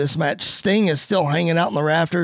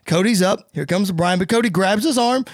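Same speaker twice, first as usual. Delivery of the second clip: usual speaking voice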